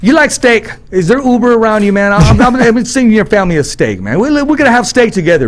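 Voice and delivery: high-pitched, eager buddy impression; Brad. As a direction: imitate a pal pushing his steak invitation. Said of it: "then he goes like this".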